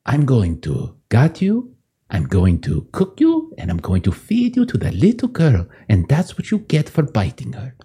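menacing butcher. Acted. Butcher threatens the baby goat he holds by the neck, menacing. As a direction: draw out each threat as the butcher. Game master voices the angry butcher.

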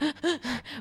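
quick, shuddering crying gasps; soft mock sniffly gasping; imitated crying sniffles. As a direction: mimic sobbing breath catches. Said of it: "I was crying. I was like".

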